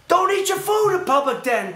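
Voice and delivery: fed-up rant at a stranger; exasperated scolding. Acted. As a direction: scold the offender loudly. Scolding an imagined public eater.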